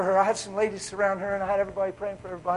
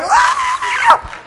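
very high, loud joyful shout; crowd shout; 17 dB louder than usual. Retold or imitated. Congregation shouting in amazement at the healing, very high. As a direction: let out the congregation's cry. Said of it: "And they went".

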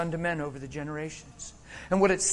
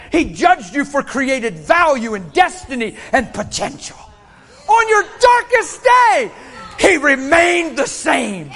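loud, high, stretched-out proclamation; full-force preaching; 8 semitones higher, 9 dB louder, slower than usual. Real, not acted. Preacher telling congregation of unchanging love, full-force preaching. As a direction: ring out each phrase slowly at full voice.

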